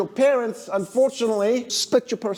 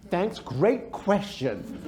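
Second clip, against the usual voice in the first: sarcastic thanks; 3 dB quieter, much slower than usual. Acted. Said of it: "You don't go".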